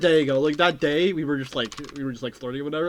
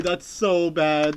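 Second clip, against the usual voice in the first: drawn-out groan; much slower than usual. Real, not acted. Repeated reactions to DM.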